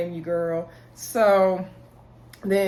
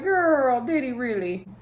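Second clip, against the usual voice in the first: skeptical; 5 semitones higher, slower than usual. Retold or imitated. Doubts friend's claim he scared her.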